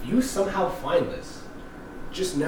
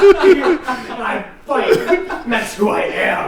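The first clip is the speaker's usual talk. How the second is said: laughing, higher and louder, proud of it; laughing boast; 6 semitones higher, 7 dB louder, much slower than usual. Real, not acted. Teased by friends about preschool fights, laughing boast. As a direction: crack up while owning it.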